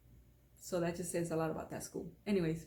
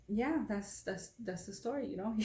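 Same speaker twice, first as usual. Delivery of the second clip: ordinary talk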